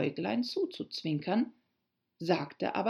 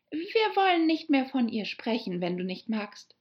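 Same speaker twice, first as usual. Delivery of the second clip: placating Alice; 7 semitones higher than usual. Acted. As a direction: back off conciliatorily as Alice. Alice hastily placating the offended mouse. (beschwichtigende Mädchenstimme, anfangs hoch)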